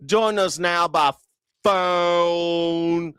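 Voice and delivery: high, slow drawled "Join us now by phone"; mock Southern newsreader. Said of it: "Southerners ... gotta be like".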